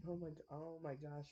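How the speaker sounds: usual speaking voice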